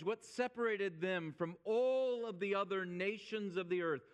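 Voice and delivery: ordinary talk, nothing marked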